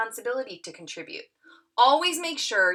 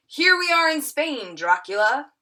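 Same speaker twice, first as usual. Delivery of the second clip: bold scene opener; 6 semitones higher, 6 dB louder than usual. Acted. Acted confident scene opener.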